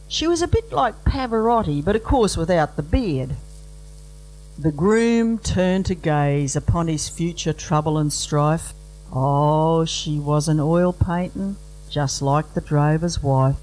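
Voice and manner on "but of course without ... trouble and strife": ordinary talk, nothing marked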